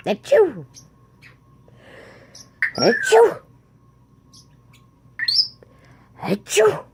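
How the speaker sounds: high play sneezes, one after another; fake sneeze. Shown as a demonstration. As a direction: show the bird how to sneeze. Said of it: "That's not even anything like sneezing".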